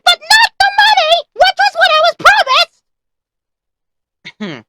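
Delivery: interrupt, furious about being stiffed; indignant protest. Acted. Cuts in complaining about payment.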